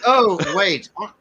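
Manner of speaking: ordinary delivery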